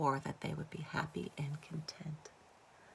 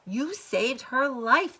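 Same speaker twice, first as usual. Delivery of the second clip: insistent father; 6 semitones higher, 9 dB louder, slower than usual. A narrator's reading. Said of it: "the father said".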